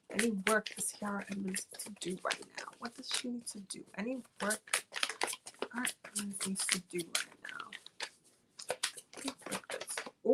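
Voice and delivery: hushed whisper asking the cards; soft secretive whisper to the deck. Real, not acted. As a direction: whisper the question under your breath. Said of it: "whispered question to the cards".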